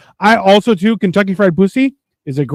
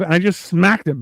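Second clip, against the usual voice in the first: ordinary talk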